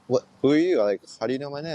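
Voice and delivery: imitating a taken-aback girl; retold puzzled challenge. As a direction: act out her baffled questioning. Retelling her puzzled challenge.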